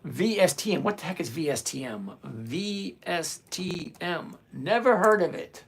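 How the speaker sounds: ordinary talk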